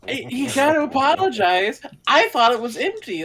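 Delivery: high, loud mock defensiveness; hammed-up flustered excuse; indignant protest. Acted protest in character.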